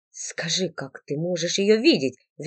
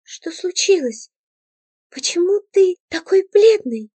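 put-on a girl kneeling, worried over him; 10 semitones higher than usual. A narrator's reading. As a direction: fuss over him in concern. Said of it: "обеспокоенными глазами".

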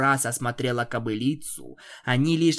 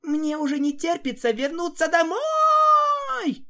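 excited shout; 5 dB louder than usual. Acted. Cheerful shout cut off.

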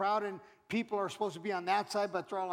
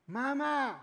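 imitated kid's higher-pitched call; mock child's voice calling for mom; child calling mother; 5 semitones higher than usual. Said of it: "she recognizes that voice".

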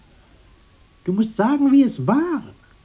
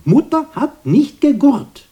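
insistent outburst; 7 dB louder than usual. Acted. Insisting loudly.

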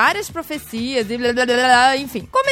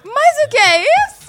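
surprised; 12 semitones higher, 5 dB louder than usual. Real, not acted. Shocked reaction to a confession.

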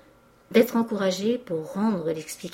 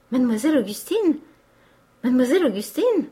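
higher, louder joyful exclamation; enthusiastic cry; 5 semitones higher, 5 dB louder, faster than usual. A narrator's reading. Clerk cries out his beloved's name, enthusiastic. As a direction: cry the name out in delight, twice over. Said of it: "s'écria Joseph Lebas dans son enthousiasme".

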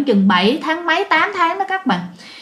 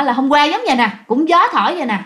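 ordinary delivery, nothing marked

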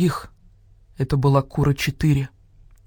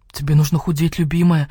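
plain reading voice

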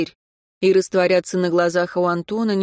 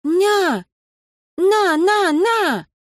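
put-on high, loud toddler voice; baby babble; 12 semitones higher, 5 dB louder, much slower than usual. Baby demands to be held, high toddler babble. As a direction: call like a little child reaching out.

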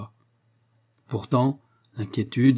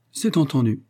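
cheerful agreement, slightly higher; joyful; 4 semitones higher, 3 dB louder than usual. A narrator's reading. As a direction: agree with understated joy. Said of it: "fit joyeusement Léon".

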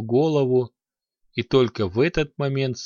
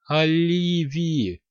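desperate cry; 5 semitones higher, much slower than usual. A narrator's reading. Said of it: "в отчаянии вскрикнул Фортаэль".

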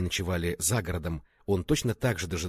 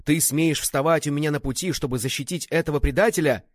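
raised, loud, indignant demand; angry challenge; 6 semitones higher, 5 dB louder than usual. A narrator's reading. Father confronting the traitor's defender, angry challenge. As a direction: challenge the one blocking your path.

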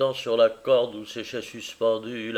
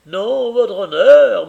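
slow, high, loud beggar's plea; a beggar voice pitched high; pleading beggar; 7 semitones higher, 11 dB louder, slower than usual. Retold or imitated. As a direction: beg him humbly and loudly. Caleb voicing the beggar.

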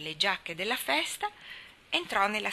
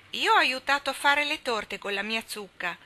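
put-on a child's eager shout, raised and loud; 6 semitones higher, 5 dB louder than usual. A narrator's reading. Robbie shouting his share of the feast to the table. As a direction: call it out proudly across the table. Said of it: "gridò Robbie".

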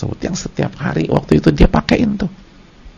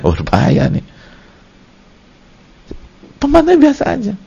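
high, loud sermon voice; full-force preaching; 7 semitones higher, 6 dB louder than usual. Acted. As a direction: preach it out to the congregation. (ceramah berapi-api dengan suara tinggi)

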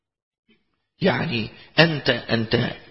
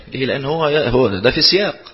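ordinary delivery

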